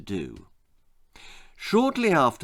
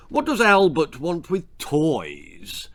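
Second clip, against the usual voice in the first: slow, louder, dismissive; scornful; 4 semitones higher, 4 dB louder, slower than usual. A narrator's reading. Amos dismisses Betty's idea, slow and scornful. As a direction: sneer at the notion. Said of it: "laughed Amos".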